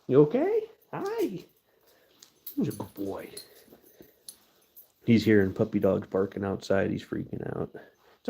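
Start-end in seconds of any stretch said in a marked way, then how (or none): 0.1-3.3 pet talk. Real, not acted. Talking to the dog.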